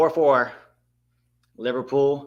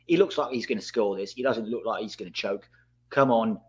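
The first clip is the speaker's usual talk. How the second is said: usual speaking voice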